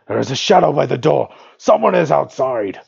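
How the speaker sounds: high, loud goblin shout; strained orc voice. Acted. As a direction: cry out like an alarmed goblin. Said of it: "I can't even do my normal orc voice".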